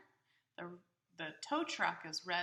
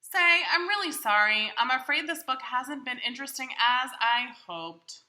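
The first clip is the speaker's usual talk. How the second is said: higher, louder, cheerful Big Bird puzzling over his bag; 5 semitones higher, 14 dB louder than usual. Acted. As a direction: play a big friendly bird talking to the child. Reader voicing Big Bird's lines.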